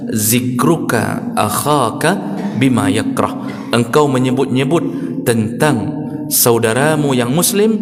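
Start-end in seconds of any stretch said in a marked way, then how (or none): 0.1-3.5 slow, drawn-out Arabic quotation; Arabic hadith recitation. Retold or imitated. Preacher quoting the Prophet's Arabic words slowly.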